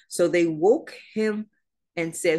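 normal talking voice

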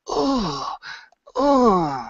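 high sighing ooh; longing ooh. Shown as a demonstration. Teacher voices the desire, longing ooh. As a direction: sigh out a yearning ooh. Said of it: "this desire into it".

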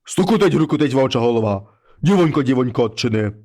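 low, heavy voice of a wolf head at the door; low monster head voice. Acted. Wolf head knocks and calls the girl, low and heavy.

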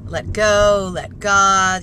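said out loud, firmly, to herself; loud self-reminder. Real, not acted. Driver reminding herself to let go, loud mantra. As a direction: push the words out like a mantra.

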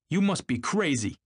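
high-pitched exclamation of disbelief; exclaiming. A narrator's reading. Tom reacting to Gatsby's claim, automatic exclamation. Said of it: "exclaimed Tom automatically".

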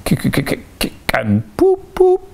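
stuttered clicks, then hooting poops; suppressed snorting noises. A narrator's reading. Toad making suppressed noises inside him, stifled snorts. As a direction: imitate stifled snorts bursting out. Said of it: "making strange suppressed noises inside him".

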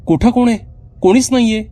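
put-on high, disbelieving child voice; a girl insisting nobody is there; puzzled girl. Sheena tells her sister nobody is there, puzzled.

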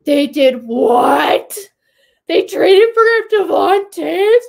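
high, loud, slow stunned yelling; freaked-out disbelief. Retold or imitated. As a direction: act out the beat writer losing it on air. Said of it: "he freaked out".

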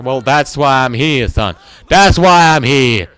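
loud, drawn-out comeback; mock defiant retort. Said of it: "I'm gonna be like".

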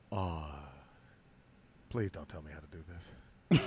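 deadpan Shatner put-down, low and hushed; Shatner impression. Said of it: "and he goes".

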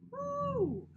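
cheer. Real, not acted.

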